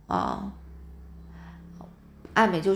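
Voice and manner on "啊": ordinary delivery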